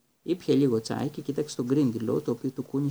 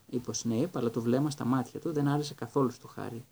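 ordinary delivery, nothing marked

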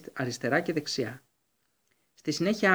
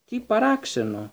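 amused; 7 semitones higher, slower than usual. A narrator's reading. Dumbledore feigning surprise at the missing beast, amused and slow. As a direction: pretend puzzlement, privately entertained. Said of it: "μία νότα ευθυμίας".

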